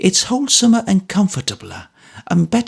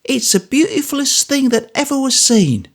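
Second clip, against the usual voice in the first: exclaiming; 14 semitones higher than usual. A narrator's reading. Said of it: "Missus Bagnet exclaims".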